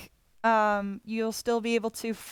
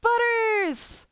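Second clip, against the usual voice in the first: excited calling; 13 semitones higher than usual. Real, not acted. Excited greeting of the cat.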